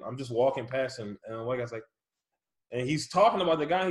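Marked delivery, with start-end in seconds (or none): none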